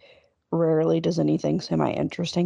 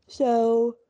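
yawning; 6 semitones higher than usual. Real, not acted. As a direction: yawn breaking through. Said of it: "here my yawns go".

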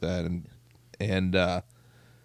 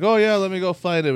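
higher, louder fake-friendly reply; mock helpful; 10 semitones higher, 5 dB louder than usual. Tom stringing along a telemarketer, mock cheerful. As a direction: play along sweetly to waste their time. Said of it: "he's like".

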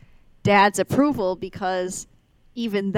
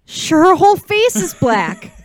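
1 mock high voice; 9 semitones higher, 9 dB louder than usual.

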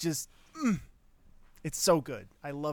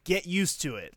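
louder, higher, blunt declaration; blunt announcement; 5 semitones higher, 6 dB louder than usual. Retold or imitated. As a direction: say it bluntly like a promo. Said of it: "in the promo thing are like".

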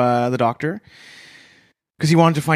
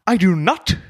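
Jim Carrey attempt; 5 semitones higher, 3 dB louder than usual. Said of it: "that was my little attempt".

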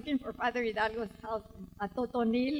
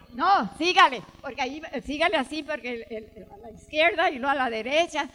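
fast local directions; 7 dB louder, faster than usual. Retold or imitated. A local man rattles off directions to the lost visitor, fast. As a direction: rattle off directions at speed, louder. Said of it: "this is the way he talked".

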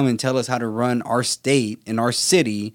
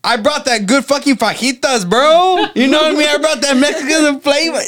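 much higher and louder, brash Texan bro voice; hammed-up proud Texan; 11 semitones higher, 5 dB louder than usual. Teasing friends about moving here, loud high bro voice. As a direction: play up the valley homie bragging, loud and high. Joking about being from the valley.

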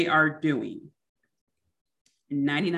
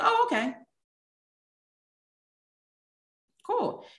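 much higher breezy easygoing voice; agreeable friend. Retold or imitated. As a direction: chirp it out carefree and light. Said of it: "they go".

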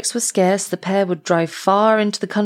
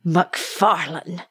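drawn-out, half-voiced exclamation; mild cry of alarm; much slower than usual. A narrator's reading. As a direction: call the name slowly, in understated shock. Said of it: "cried Fettes".

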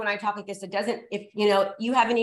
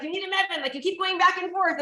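lightly voiced mom, a bit higher; a hint of a scolding mother, pitched up; mom's voice; 6 semitones higher than usual. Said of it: "she's like".

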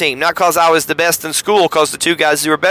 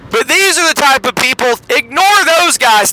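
dismissive pastor's order; 7 semitones higher than usual. Retold or imitated. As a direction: brush the unpopular kids aside with a raised voice. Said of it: "That's the youth minister who told me that".

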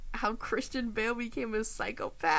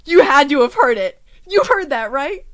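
excited; 6 semitones higher, 16 dB louder than usual. Real, not acted. Insists she heard the wind.

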